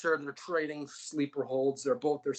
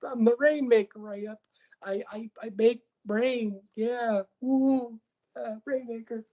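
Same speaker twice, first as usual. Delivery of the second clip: high, goofy whiny voice; high, goofy whiny voice of Kazuchika Okada; Okada impression; 11 semitones higher than usual. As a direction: play a needy clown begging for love. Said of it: "Kazuchika Okada comes out and he's like".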